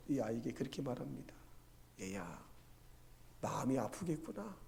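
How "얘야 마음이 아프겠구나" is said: soft, tender acted comfort; consoling grandmother. Grandmother to crying grandchild, soft and consoling. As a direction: drop to a gentle, soothing voice.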